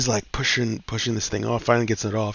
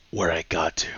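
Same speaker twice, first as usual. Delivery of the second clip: whispered, cool action-hero line; whispered one-liner; 4 semitones lower than usual. Retold or imitated. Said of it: "this is the part where he goes".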